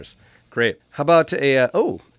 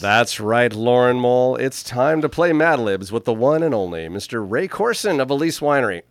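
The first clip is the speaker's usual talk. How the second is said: booming show host intro, louder; announcer voice; 3 semitones higher, 6 dB louder than usual. Pretend. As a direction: hype up the game like a host. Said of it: "How's that for my announcer voice?".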